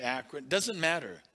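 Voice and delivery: ordinary talk, nothing marked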